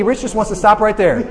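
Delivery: ordinary talk, nothing marked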